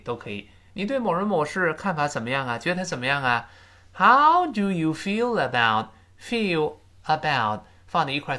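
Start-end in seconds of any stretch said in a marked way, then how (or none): 3.9-7.6 "feel about" slowly, the L running into "about"; slow linking demo. Shown as a demonstration. Teacher showing pupils how "feel" links into "about". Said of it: "自然连读成feel about".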